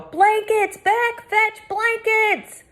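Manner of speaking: slow high loud commands; calling. A narrator's reading. A man relaying the call for blankets. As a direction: bark the order back up the path. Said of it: "A man's voice had taken up the call".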